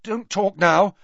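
anxious plea; worried. A narrator's reading. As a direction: hush him urgently. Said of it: "fearing how slurred".

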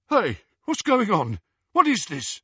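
high, urgent questioning; calling out in alarm. A narrator's reading. Yaxley bursts in on the escape, alarmed. Newcomer calls across hall in alarm.

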